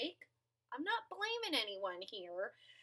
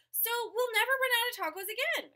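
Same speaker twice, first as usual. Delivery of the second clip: excited; 8 semitones higher, 5 dB louder, faster than usual. Acted.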